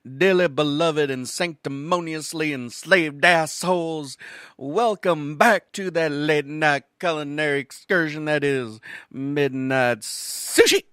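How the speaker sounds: slow, loud announcer welcome over music; grand showman opening, drawn out; show host intro. Acted. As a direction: boom it out like a late-night MC.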